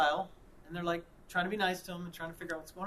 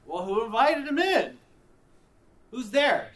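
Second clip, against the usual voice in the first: high, loud mock disbelief; incredulous outburst; 6 semitones higher, 11 dB louder than usual. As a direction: blurt it out far louder, pitch jumping up. Acting out his alarmed phone reply.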